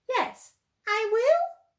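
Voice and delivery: high, loud, slow and eager; eager yes. Acted. Plop agreeing to explore with the cat, high and eager. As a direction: burst out with yes.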